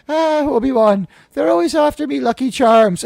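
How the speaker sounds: high, loud hammed-up Irish brogue in the Qui-Gon line; mock an Irish leprechaun accent, way up high. Mocking Qui-Gon's Irish voice for his podcast co-host, hammed-up Irish brogue. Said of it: "with his Irish voice".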